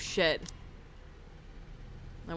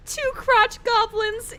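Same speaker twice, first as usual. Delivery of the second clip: amused; 11 semitones higher, 4 dB louder, slower than usual. Real, not acted. Laughing at twins news.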